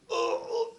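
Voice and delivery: mock wounded groan; unvoiced grunt as if hit; acted gasp of pain. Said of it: "and you're like".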